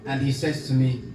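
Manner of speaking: ordinary talk, nothing marked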